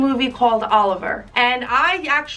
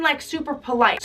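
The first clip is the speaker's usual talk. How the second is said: usual speaking voice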